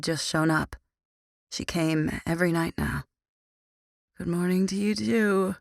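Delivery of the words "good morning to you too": ordinary delivery, nothing marked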